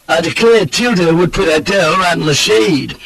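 high, gushing Southern gallantry; effusive old roué flattering a lady; gallant cry. A narrator's reading. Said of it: "cries the other roue gallantly".

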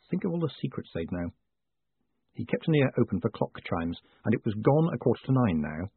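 ordinary delivery, nothing marked

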